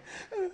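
high, hammed-up sobbing noise; imitated crying. Storyteller showing how he cried, mock hysterical sob. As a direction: mimic a hysterical crying fit. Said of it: "this type of crying is like".

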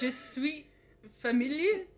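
hammed-up French accent, rising on familier; mock French. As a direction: show off fake French with a grin. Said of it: "How do you say that in French?".

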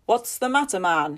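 loud, sharp question; urgent demand. Acted. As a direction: demand to know at once. Father demands news.

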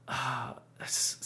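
slow, breathy whisper of disbelief; whispered aside. Real, not acted. Podcaster whispers to himself about a clueless cartoon character. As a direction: drop to a whisper, dumbfounded.

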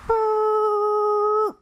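long, high, loud sustained "whoo"; one piercing held note for the skid; imitated screeching halt. Horse stopping at the cliff's edge, imitated screech.

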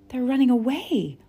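high, loud cry of alarm. A narrator's reading. Laura to Lena as the ponies bolt, crying out. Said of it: "Laura cried out".

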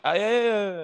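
high, mock-polite string of yeahs; hurried brush-off. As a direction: act out replying while trying to get away. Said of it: "walking behind, like, turning around, being like".

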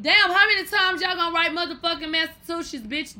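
loud, high, fed-up complaint; exasperated outburst. Real, not acted. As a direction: blow up at the word. Exasperated rant at repeated word.

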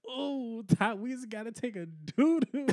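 put-on high, whiny kid voice; mocking classmate. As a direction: mimic a goofy schoolkid asking to go. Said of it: "he used to be in class like".

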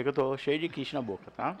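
ordinary talk, nothing marked

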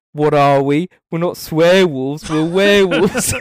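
a bad New Zealand accent, drawn out; terrible New Zealand accent. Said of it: "That was my terrible accent".

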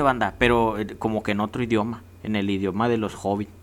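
normal talking voice

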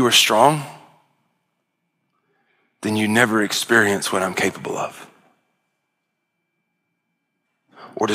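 low, hushed preaching to the hurting. Acted. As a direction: lean in and speak gently to the congregation. Soft, intimate closing appeal.

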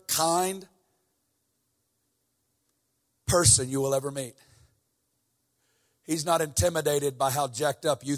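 tender preaching. Acted. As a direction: ease down and speak it tenderly.

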